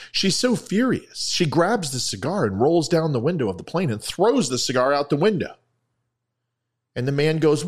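high-pitched, escalating storytelling; animated joke telling. A narrator's reading. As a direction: tell it with mounting excitement. Joke narration builds in excitement.

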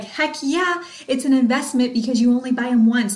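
imitating her fired-up younger self. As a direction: sell it like you truly believe it. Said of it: "I'm getting all fired up".

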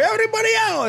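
very high, fast mock yell; hammed-up high-pitched evacuation call; acted calling out. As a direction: call out a jump order in pretend panic. Acting order to jump from plane.

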